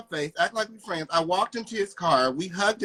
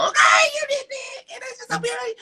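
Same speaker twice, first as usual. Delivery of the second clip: high squealed praise, much higher; excited squeal. Real, not acted. Woman squealing at her rival's compliment, excited. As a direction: squeal it out in delight.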